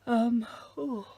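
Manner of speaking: talking through a yawn; words stretched by a real yawn. Real, not acted. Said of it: "sorry, I keep yawning on camera".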